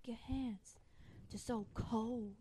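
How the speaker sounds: soft, slow whispered murmur; mock intimate whisper. Retelling Han taking Leia's hands, soft mock-romantic whisper.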